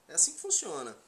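ordinary talk, nothing marked

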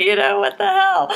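high, loud, incredulous outburst; mock exasperation. Student reacting to scholarly talk, mock exasperation. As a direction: exclaim it in amused disbelief.

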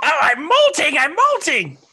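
high, loud shrieking harpy wail; hammed-up high-pitched squawk of dismay; panicked harpy. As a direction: cry out in mock alarm as a molting bird. Voicing a harpy losing feathers.

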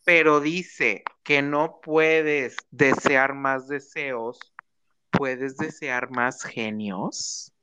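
slow. Pretend. Pregunta de reina de belleza.

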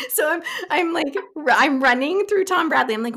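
usual speaking voice

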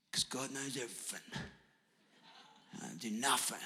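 very soft, low, half-whispered inner thought; hushed passive musing. Acted. Preacher voicing a beggar's idle thoughts to himself, hushed and passive. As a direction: murmur it lazily to yourself, barely voiced.

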